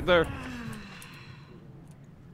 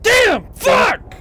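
sudden loud high yell; frustrated shout; 7 dB louder than usual. Real, not acted. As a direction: blurt it out in frustration. Frustrated gamer losing fight.

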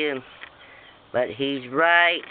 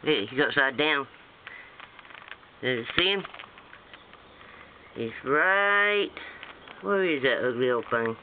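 normal talking voice

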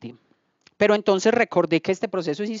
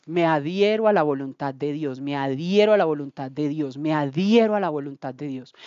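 solemn prayerful vow; 7 semitones lower, 3 dB quieter than usual. Retold or imitated. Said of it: "pero yo dije".

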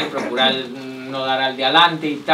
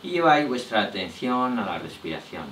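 soft, low voice in short phrases with long pauses; hushed, unhurried body-scan meditation; guided relaxation; 7 semitones lower, 12 dB quieter than usual. Real, not acted.

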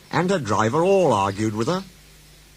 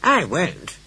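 high, stubborn lady diesel; Daisy refusing; 8 semitones higher than usual. Acted. Daisy refuses her driver, stubborn. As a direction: refuse with pitch raised. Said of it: "said Daisy".

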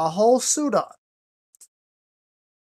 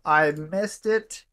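ordinary talk, nothing marked

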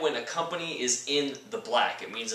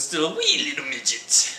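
hammed-up Irish accent; jokey, not-quite-landing Irish accent; 4 semitones higher than usual. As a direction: try a mock Irish lilt. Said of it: "That's my Irish accent".

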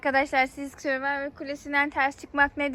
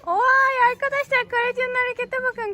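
high, bright cheer for her daughter; excited cheering; 9 semitones higher, 5 dB louder than usual. Real, not acted. Mother cheering child's climbing move.

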